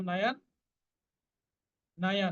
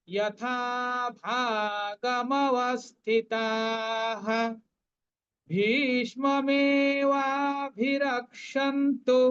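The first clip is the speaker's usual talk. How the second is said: slow, higher Sanskrit chant; full verse recitation; 4 semitones higher, 3 dB louder, much slower than usual. Shown as a demonstration. Teacher reciting the complete Gita verse to the class. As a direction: intone the whole verse slowly. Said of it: "পূর্ণ শ্লোকটা আবার পড়ছি".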